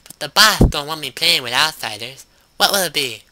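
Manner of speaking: chirpy little goblin shopkeeper; lisping goblin voice. Acted. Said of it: "in a thick lisp".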